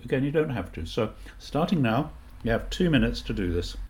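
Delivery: usual speaking voice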